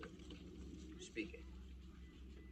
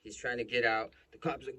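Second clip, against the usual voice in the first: ordinary talk, nothing marked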